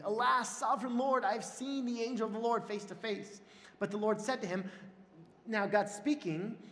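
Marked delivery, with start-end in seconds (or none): 0.0-3.4 higher-pitched, read-aloud cry of alarm; mild exclamation. A narrator's reading. Gideon realizing he saw the Lord's angel, mildly exclaimed. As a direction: exclaim it in awe, a touch dramatic. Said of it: "he exclaimed".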